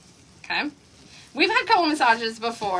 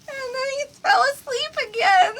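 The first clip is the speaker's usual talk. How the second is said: laughing hard; 12 semitones higher than usual. Real, not acted. Recounting husband falling asleep again.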